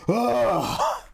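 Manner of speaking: very high, stretched-out wail ending in a yelp; mock panicked reaction. Streamer acting out her panic when asked to share art, high and slow. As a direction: ham up the flustered artist. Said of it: "instant reaction is literally like".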